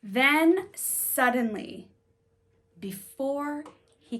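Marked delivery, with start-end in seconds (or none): none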